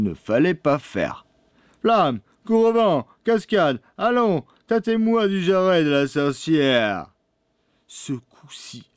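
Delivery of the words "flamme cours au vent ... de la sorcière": high-pitched calls to the dogs; commanding boy. A narrator's reading. Pierrot sets his dogs on the witch, high urgent command. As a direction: call each dog up high, urging them on.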